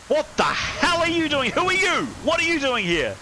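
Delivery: sudden high shouted challenge; panicked sailor. Acted. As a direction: bark it out like a startled deckhand.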